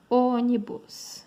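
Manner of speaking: ônibus slow, with a long weighted Ô; stress on antepenultimate syllable. Shown as a demonstration. Teacher showing students a proparoxytone, slow ônibus stressed on Ô. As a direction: stretch the opening Ô of ônibus. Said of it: "antepenúltima".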